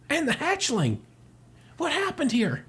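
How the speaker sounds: high-pitched hammed-up shock; high shocked exclamation. Samus finding the hatchling, high dramatic shock. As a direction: exclaim high, as if just discovering it.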